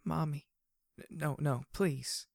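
put-on high, childlike pleading; soft whimper. Glory calls for Mommy in her sleep, high whimper. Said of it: "she whimpered softly".

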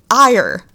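"Ire" said firmly and loudly; pronounce Ire. Shown as a demonstration. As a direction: repeat "Ire" with emphasis. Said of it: "Ire as in rage".

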